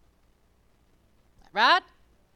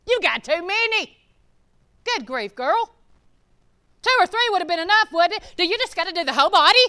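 high, loud, rushing scold; hammed-up scolding gossip; mocking judgmental churchgoer; 9 semitones higher, 13 dB louder than usual. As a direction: rattle off the disapproval. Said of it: "They got tattoos".

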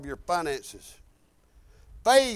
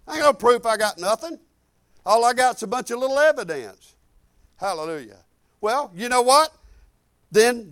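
ordinary talk, nothing marked

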